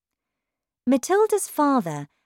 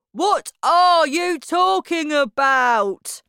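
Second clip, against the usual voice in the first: loud, higher slow shout; 10 semitones higher, 8 dB louder, slower than usual. Acted. Husband shouting back at his wife, indignant. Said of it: "shouted Mister Wormwood".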